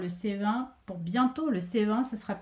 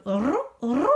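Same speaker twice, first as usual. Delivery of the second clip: imitated pigeon coo with rolled r; 5 dB louder than usual. Shown as a demonstration. Teacher showing pupils the pigeon's noise, imitated cooing. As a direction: coo like a pigeon, two separate calls. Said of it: "le verbe qui indique le bruit du pigeon".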